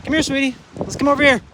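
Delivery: high, sweet call to the dog; coaxing puppy talk. Real, not acted. Owner coaxing his puppy inside, high sweet call. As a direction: lure the puppy over gently.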